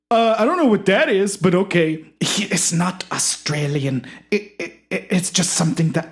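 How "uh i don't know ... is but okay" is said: much higher and louder, welcoming; high eager host voice. Acted. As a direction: usher the guest in brightly.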